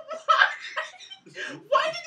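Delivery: laughing, high and loud why; laughing disbelief. Retold or imitated. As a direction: cry out why through laughter. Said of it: "And I was like".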